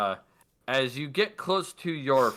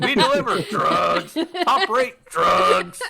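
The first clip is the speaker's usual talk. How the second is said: loud, drawn-out sales shout; mock drug advert; 7 semitones higher, 9 dB louder, slower than usual. Game master hawks drug delivery, loud and drawn out. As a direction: stretch each slogan out loud and high.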